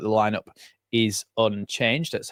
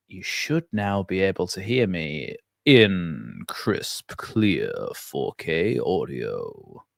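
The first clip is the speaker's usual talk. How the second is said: mock announcer; 4 semitones lower, much slower than usual. Showing off the new mic.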